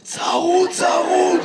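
high, strained mock-hoarse croak; imitated hoarseness. Comic teasing an audience man about his voice, mock hoarse. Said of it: "ממה אתה צרוד".

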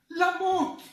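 high-pitched dramatic repeat; dramatic exclamation. Retold or imitated. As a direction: leap up high for theatrical emphasis. Exclama escandalizado que ella quiere morir.

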